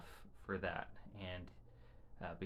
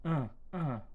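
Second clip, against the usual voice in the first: higher, louder grunted no from the son; imitating her son; 7 semitones higher, 4 dB louder than usual. Mother imitating her nonverbal son's grunted reply, higher. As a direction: mimic the boy's short grunts. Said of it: "he goes".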